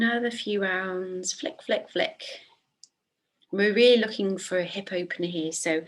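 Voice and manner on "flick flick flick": whispered rhythmic cue. Shown as a demonstration. Cueing quick foot flicks.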